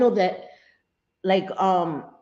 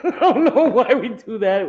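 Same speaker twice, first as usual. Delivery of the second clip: higher, louder, through laughter; laughing; 6 semitones higher, 7 dB louder than usual. Real, not acted. Laughing at herself.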